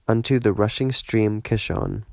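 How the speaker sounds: plain reading voice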